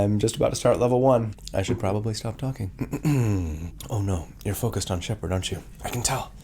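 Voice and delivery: ordinary talk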